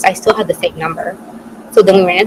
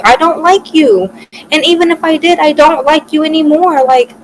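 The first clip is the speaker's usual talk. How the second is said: angry rejection; 9 semitones higher, 4 dB louder than usual. Retold or imitated. Rejecting the guy who bet on her, loud and angry. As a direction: spell it out furiously, pitched up high.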